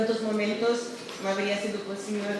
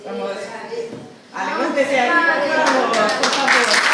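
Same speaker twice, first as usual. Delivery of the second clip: loud call across the room; 5 semitones higher, 12 dB louder than usual. Real, not acted. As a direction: urge them up from afar. Calling colleagues to stand up.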